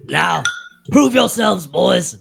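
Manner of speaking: loud high rallying yell; war cry. Acted. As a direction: shout it to rally the troops. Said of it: "I'll yell to the other dwarfs".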